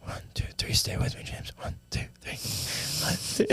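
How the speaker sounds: urgent whisper, counting compressions; whispered CPR count. Acted. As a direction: whisper the count as you pump his chest.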